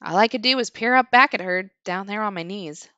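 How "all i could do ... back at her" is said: plain reading voice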